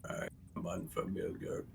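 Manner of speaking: soft unvoiced whisper; whispered construct voice. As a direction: breathe it like a hollow construct.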